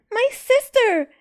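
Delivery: put-on high, loud child's shout; little girl shouting for her baby sister. A narrator's reading. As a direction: cry out in alarm. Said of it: "Zena shouted".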